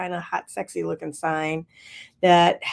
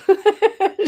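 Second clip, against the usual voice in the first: high giggle; 13 semitones higher, 8 dB louder than usual. Real, not acted. Laughs about Ruth's excitement.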